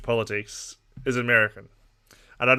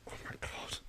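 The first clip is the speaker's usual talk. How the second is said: breathy exasperated whisper; hushed oh my God; whispered aside; 20 dB quieter than usual. Real, not acted. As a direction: drop to a whisper, fed up. Exasperated aside about England.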